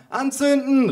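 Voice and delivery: put-on high-pitched child's cry; little boy calling. A narrator's reading. Cousin's little son wants them lit, high eager call. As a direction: pipe it up high like a small boy. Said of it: "rief der kleine Sohn meiner Cousine".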